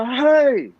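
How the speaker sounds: put-on high, loud sharp "Hai." yelled at the child; retold shout. Father shouting "Hai." at his crying toddler, loud. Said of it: "Saya kata lagu tu".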